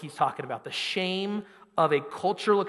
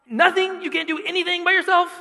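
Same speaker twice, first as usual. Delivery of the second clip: mock disbelief; 12 semitones higher, 4 dB louder than usual. Dad replies to son.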